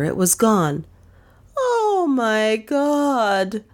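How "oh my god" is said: very slow, much higher wail; Lizzie's wail. Acted. Maid Lizzie wailing from her corner at the bat sign, high and slow. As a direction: draw out a frightened wail. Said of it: "wailed Lizzie from her corner".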